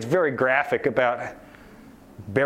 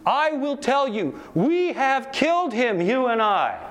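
slow, higher, solemn accusation; madman's accusation; 7 semitones higher, slower than usual. Acted. Madman accusing the crowd, slow and solemn. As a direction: pierce them with the words.

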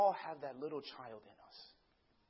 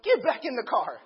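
loud, raised acted order; commanding dad; 5 semitones higher, 10 dB louder than usual. Dad to daughter walking off down the street, loud command. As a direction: call her back forcefully.

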